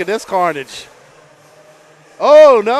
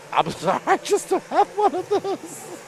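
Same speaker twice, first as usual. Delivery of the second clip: mock sheepish apology; 10 semitones higher, 5 dB quieter than usual. Announcer replays his own answer to the guy, sheepish and amused. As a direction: squeak out the apology, half laughing.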